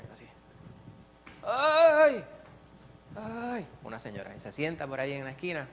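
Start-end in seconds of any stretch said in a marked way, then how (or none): 1.4-3.7 high, loud strained "ay" of a sick woman; mock groaning wail. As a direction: wail it like the complaining patient.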